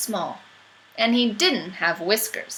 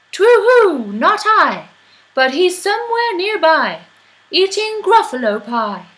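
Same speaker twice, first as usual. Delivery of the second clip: high, loud hooting owl voice; 8 semitones higher, 9 dB louder than usual. Acted. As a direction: hoot it out like an owl.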